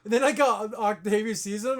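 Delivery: ordinary talk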